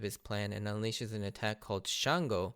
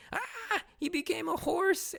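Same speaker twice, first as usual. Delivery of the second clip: shocked mock scream; slower than usual. Imitating a shocked character seeing the horse, mock scream. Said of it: "Isa is then shocked, screaming".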